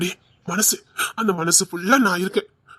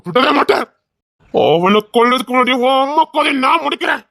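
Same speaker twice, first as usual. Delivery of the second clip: rage-filled shouted vow of revenge; furious shout. Acted. Husband swearing vengeance on her attacker, furious shout. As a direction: roar out the threat to kill.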